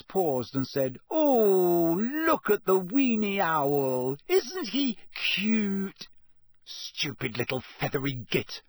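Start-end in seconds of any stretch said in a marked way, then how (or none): 1.1-6.1 high, slow, drawn-out gushing; cooing girls. A narrator's reading. Group of girls cooing over owl. 6.6-8.6 angry hiss. A narrator's reading. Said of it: "Ron hissed".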